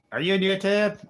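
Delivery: high, loud mock question; mock friend asking. Mimicking a friend who asks about his channel, high and loud. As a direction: squeak it out like an eager friend.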